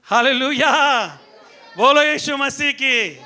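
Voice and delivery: long drawn-out praise shout; stretched congregational call-and-response cry; rallying call. Real, not acted. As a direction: call out slowly for the crowd's response.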